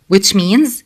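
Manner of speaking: usual speaking voice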